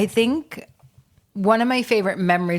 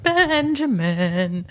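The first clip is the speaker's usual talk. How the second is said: drawn-out, higher-pitched mimicking call; Benjamin Keenan's sound; 4 semitones higher, much slower than usual. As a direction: stretch the name out very slowly, pitched up. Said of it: "That's actually the sound he made".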